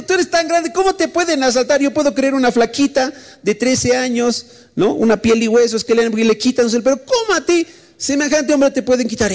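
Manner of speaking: high-pitched acted astonishment; incredulous. Teasing a big friend who got robbed, incredulous. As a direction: press the question in high disbelief.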